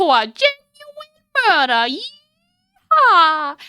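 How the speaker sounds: high, slow, hammed-up Southern drawl; bad Southern accent. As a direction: build to a whooping Southern yeehaw. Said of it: "my really bad southern accent".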